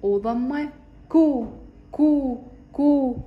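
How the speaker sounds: long, slow كو with rounded lips; drawn-out syllable demo. Shown as a demonstration. Teacher to first graders, slow syllable drill. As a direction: stretch out كو for the children. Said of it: "نشكل مقاطع حرف الك مع الحركات القصيرة".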